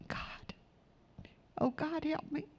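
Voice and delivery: barely voiced, pleading, "help me" rising high; breathy plea. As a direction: whisper a desperate plea to God. Sample desperate prayer.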